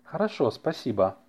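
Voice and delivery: normal talking voice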